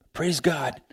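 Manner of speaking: whisper. Real, not acted. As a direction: breathe "Praise God" as a quiet whisper. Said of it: "whispered repeat of praise".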